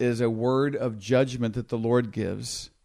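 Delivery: normal talking voice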